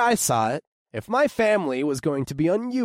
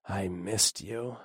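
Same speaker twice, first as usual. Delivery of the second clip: tender; 4 semitones lower, 7 dB quieter, slower than usual. Acted. Tender bedtime words to wife.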